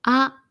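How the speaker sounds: あ said short with the mouth wide open; a clear, open あ; open a demo. Shown as a demonstration. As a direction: model vowel あ. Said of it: "口を大きく開けて発音します".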